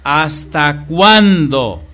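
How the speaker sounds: loud, high, slow preacher's delivery; slow, booming preacher's reading; dramatic scripture reading. Acted. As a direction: thunder God's words to the people.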